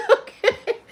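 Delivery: high, loud burst of laughter; laughing. Real, not acted. Cracking up over the therapist story, laughing. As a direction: squeeze the word out through laughter.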